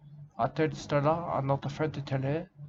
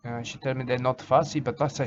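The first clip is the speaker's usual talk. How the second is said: ordinary talk, nothing marked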